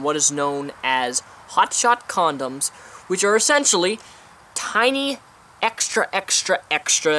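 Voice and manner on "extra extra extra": each "extra" punched harder, slowing down; stressing extra. Real, not acted. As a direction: lean on every "extra". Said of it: "and I stress extra".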